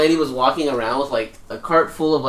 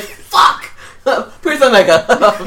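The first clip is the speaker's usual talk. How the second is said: higher, louder through laughter; laughing mock curse; 10 semitones higher, 8 dB louder, much slower than usual. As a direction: curse while cracking up. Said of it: "It's like".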